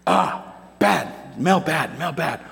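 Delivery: lightly voiced toddler complaining about a bad smell; a hint of a small child saying yuck; imitating toddler son. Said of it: "when he smells something bad".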